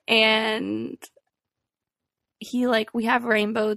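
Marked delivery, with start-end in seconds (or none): none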